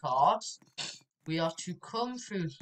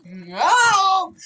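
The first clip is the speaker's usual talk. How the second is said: high, loud drawn-out scream; 11 semitones higher, 11 dB louder than usual. Real, not acted. Player thwarted in the game, screamed protest. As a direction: howl it out in protest.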